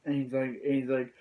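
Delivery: usual speaking voice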